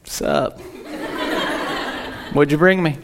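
low, whispered, bored cat; an indifferent cat voice, low and hushed; whispered, unimpressed cat; aloof cat voice. Retold or imitated. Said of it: "it's more like".

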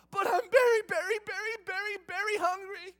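put-on loud, high whining child; whiny hungry kid. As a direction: beg like a starving kid. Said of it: "And we go".

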